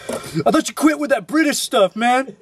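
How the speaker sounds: high, teasing friend voice; mock friends. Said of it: "everybody's gonna be like".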